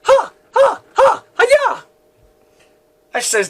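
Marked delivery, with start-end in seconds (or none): 0.0-1.8 high-pitched fighting yelps; karate cries. A narrator's reading. Elliot punching and kicking demon guards, karate cries. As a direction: yelp with each karate strike. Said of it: "demon guards like karate".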